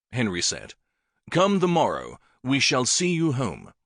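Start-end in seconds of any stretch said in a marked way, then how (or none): 1.3-3.7 higher, kindly king; king promises to take the boy home; king's promise. Acted.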